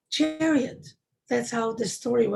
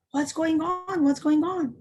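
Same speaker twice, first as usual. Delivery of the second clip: higher, slightly raised questioning as the king; alarmed demand; 5 semitones higher, 4 dB louder than usual. Retold or imitated. King to his guards amid the commotion, mildly alarmed demand. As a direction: act out a king demanding an explanation.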